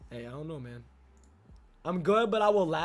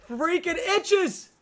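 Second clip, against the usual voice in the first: high, drawn-out complaint; exasperated exclamation; 10 semitones higher, much slower than usual. Real, not acted. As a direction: whine it out high and slow. Exclaims about his itchy nose.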